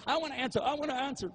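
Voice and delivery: high, eager pleading; eager to answer. Retold or imitated. Preacher mocks the crowd eager to answer: high, eager pleading. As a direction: beg to answer like an eager pupil.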